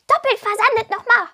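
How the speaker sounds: high, loud grumpy kobold curse; cursing. A narrator's reading. Angry kobold cursing at the sea girl, high and loud. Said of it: "fluchte er".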